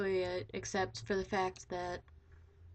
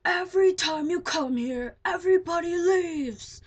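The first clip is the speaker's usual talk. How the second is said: much louder, much higher screaming fit; 9 semitones higher, 11 dB louder than usual. Retold or imitated. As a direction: scream it at him, close to crying. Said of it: "started screaming at him".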